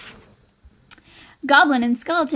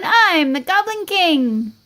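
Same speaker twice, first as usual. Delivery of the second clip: higher, drawn-out boast; proud play king; 4 semitones higher, slower than usual. Acted. Goblin trying on Skeleton's old crown, proud and grand. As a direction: announce it grandly, stretching the words.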